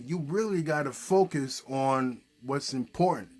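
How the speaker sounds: usual speaking voice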